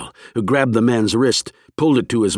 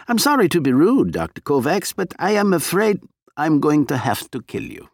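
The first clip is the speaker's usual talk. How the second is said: higher, politely menacing spy; polite menace; 7 semitones higher than usual. Acted. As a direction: apologise while threatening to kill. Agent threatens with pistol.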